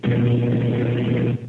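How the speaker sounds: ordinary talk, nothing marked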